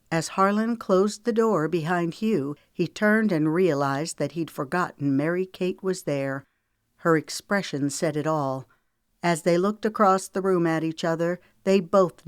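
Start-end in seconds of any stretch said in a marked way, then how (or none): none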